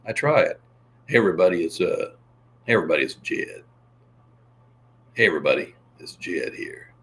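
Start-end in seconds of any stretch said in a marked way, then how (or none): 1.1-6.9 half-failed Jed voice, lower; Jed impression. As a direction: lower voice, a rough attempt. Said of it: "Can you imitate Jed?".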